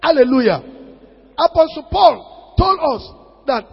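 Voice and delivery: loud, high, shouted sermon delivery; preaching at full force. Acted. Preacher exhorts the congregation, shouting with fervour. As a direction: thunder it out to the congregation.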